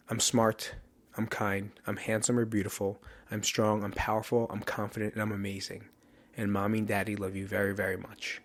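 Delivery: bedtime affirmation. Retold or imitated. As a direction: recite it quietly, as to kids at night. Reciting nightly affirmations said with kids.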